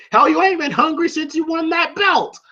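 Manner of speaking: high mock tirade; heated scolding. Mickey scolding Rocky as complacent, heated imitation. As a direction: imitate a trainer telling off his fighter.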